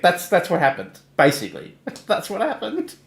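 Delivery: ordinary talk, nothing marked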